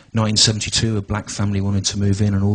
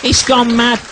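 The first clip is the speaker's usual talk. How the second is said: put-on squeaky high, louder old lady voice, slow; shrill grandma in full indignation; outraged nan; 12 semitones higher, 3 dB louder, slower than usual. Nan grumbling to grandson Stu, high squeaky outrage.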